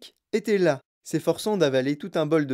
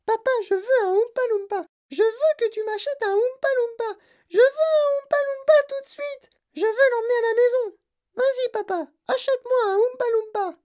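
put-on very high, whiny, demanding girl's voice; spoiled brat Veruca. A narrator's reading. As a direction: nag daddy until he gives in. Spoiled girl demanding from father.

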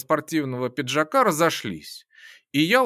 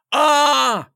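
loud pained scream; pained yell; 9 semitones higher, 7 dB louder than usual. Acted. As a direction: cry out from a broken nose. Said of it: "Он заорал".

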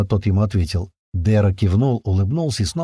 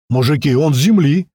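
loud, higher, eager announcement; excited addition; 5 semitones higher, 5 dB louder than usual. A narrator's reading. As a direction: chime in loudly with the big news. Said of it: "добавил маленький".

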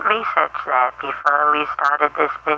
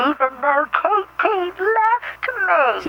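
high, slow fake crying over the breakup; hammed-up weepy lament; mock sobbing; 12 semitones higher, slower than usual. Jilted caller mourns his breakup.

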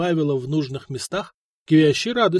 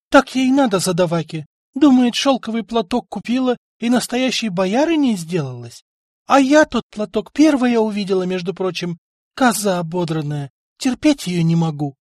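higher, louder gloating scolding; spiteful rant; 6 semitones higher, 5 dB louder than usual. A narrator's reading. Wife rants at the neighbour in a spiteful voice. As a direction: spit out scorn for the rival.

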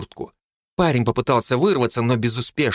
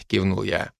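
ordinary delivery, nothing marked

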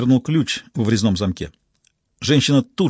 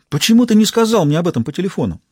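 higher-pitched, reproachful question; mild reproach; 6 semitones higher, faster than usual. A narrator's reading. Rizzoli questioning Barry about the phone call, mild reproach. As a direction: demand an explanation, mildly put out.

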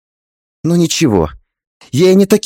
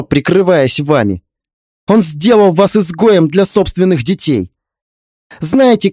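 put-on raised, pressing young woman's voice; indignant young woman; 6 semitones higher, 3 dB louder than usual. A narrator's reading. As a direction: press her hard, indignant. Heroine confronts mother-in-law.

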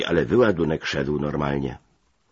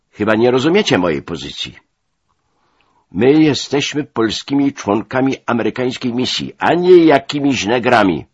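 loud, high protest, building; indignant shouting; 7 semitones higher, 8 dB louder than usual. A narrator's reading. Polish officer defends his position, shouting indignantly. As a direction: protest hotly, rising to a shout. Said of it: "krzyknąłem".

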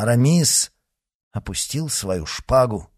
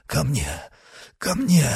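desperate call; 5 semitones higher than usual. A narrator's reading. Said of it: "слабо крикнул Мордаунд".

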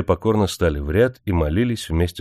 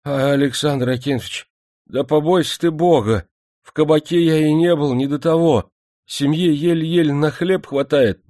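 higher, plaintive worker's plea; a poor miner begging, voice raised high; pleading miner; 8 semitones higher than usual. Acted. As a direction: beg the manager for fairness.

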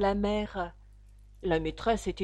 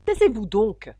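sharp command; 9 dB louder than usual. A narrator's reading. Gryphon silences Alice before she replies, loud and sharp. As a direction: cut in forcefully with a raised voice.

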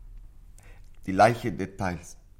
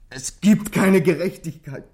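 slow, high and loud, each word wrung out; anguished outcry; 7 semitones higher, 9 dB louder, slower than usual. Acted. Boy to inspector, crying out slowly in despair. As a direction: cry out that the world is unjust. Boy justifies wanting to shoot.

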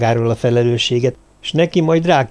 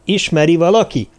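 higher, slightly firmer questioning voice; raised voice; 6 semitones higher, 3 dB louder than usual. A narrator's reading. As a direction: lift the voice to address the whole group. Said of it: "Cartwright felemelte a hangját". (felemelt hangon)

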